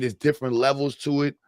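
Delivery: ordinary delivery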